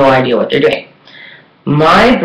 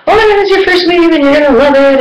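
fast, much higher gushing hairdresser imitation; all excited; 12 semitones higher, faster than usual. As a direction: rush it out, delighted for a first-timer. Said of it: "she was all excited".

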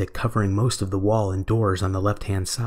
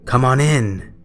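loud, higher-pitched cheery invitation; 4 semitones higher, 7 dB louder, slower than usual. A narrator's reading. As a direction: call out merrily through the door. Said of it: "said a cheery male voice".

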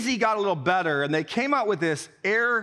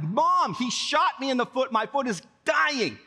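high, insistent complaint to a parent; whiny pleading; 5 semitones higher than usual. Retold or imitated. Boy to mom the next day, whiny pleading. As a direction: plead with mock-dramatic suffering.

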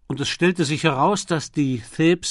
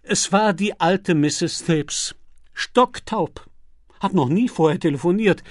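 beaming; 6 semitones higher, 5 dB louder than usual. A narrator's reading. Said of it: "verkündete er strahlend".

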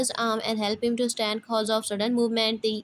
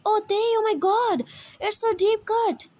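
much higher, alarmed cry over a wound; shocked gasp; 10 semitones higher than usual. Acted.

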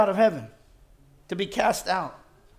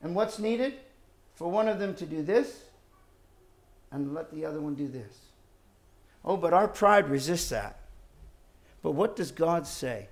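normal talking voice

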